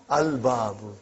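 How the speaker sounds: "Al-babu" with the l clearly sounded; lam pronounced. Shown as a demonstration. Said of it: "You did pronounce lam".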